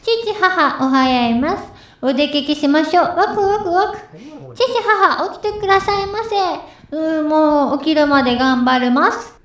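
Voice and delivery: put-on high cute anime girl voice; bubbly little anime girl; Anya-style anime voice. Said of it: "今の方が似てましたね。".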